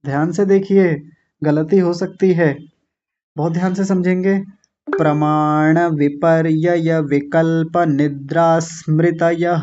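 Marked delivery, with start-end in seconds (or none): 4.9-9.6 प्रमाण विपर्यय विकल्प निद्रा स्मृतयः linked without pauses, drawn out; in one flow. Shown as a demonstration. Teacher showing the flow, slow unbroken recitation. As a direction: chant the sutra slowly on a level pitch. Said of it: "एक बार flow में कैसे बोलेंगे".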